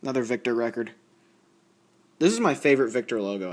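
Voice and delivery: usual speaking voice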